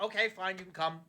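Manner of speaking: soft, resigned mumble; grudging. Retold or imitated. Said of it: "they're like".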